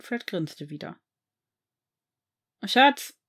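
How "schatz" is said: calls across the flat, higher and louder; calling out. Acted. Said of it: "rief er".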